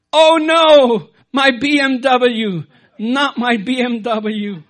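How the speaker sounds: loud wail of dismay over the car; exclaiming. Retold or imitated. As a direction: cry out in mock horror, then sag. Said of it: "the police could hear him exclaim".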